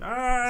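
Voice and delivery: high loud mock scream of pain; mock burning scream. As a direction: let out a hammy scream as if on fire. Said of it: "just going".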